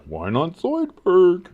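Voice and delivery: a hint of Zoidberg; Zoidberg impression. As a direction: drawl it like the cartoon crab. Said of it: "giving a little Zoidberg".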